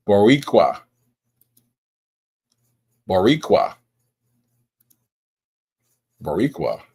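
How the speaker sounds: "Boricua" said bo-REE-kwa, with a kwa ending; careful pronunciation. Shown as a demonstration. Streamer practising a viewer's name, careful "Boricua" with kwa ending. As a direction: sound out "Boricua" as bo-REE-kwa, spaced apart. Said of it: "I am determined to get this right".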